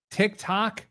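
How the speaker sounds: ordinary talk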